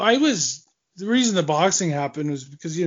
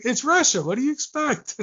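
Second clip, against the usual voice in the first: higher, shrugging "It's Russia"; imitating a Russian guy; 9 semitones higher than usual. Retelling what the Russians always say, a shrugging high voice. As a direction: toss it off like a weary local. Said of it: "the Russian guy is like".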